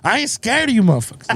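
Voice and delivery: high-pitched comic bravado impression; Bernie Mac. Quoting the comedian for a laughing co-host, mock defiant. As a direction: put on a comedian's defiant stage holler. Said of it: "Bernie Mac right there".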